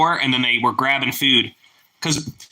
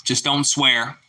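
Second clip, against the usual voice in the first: ordinary talk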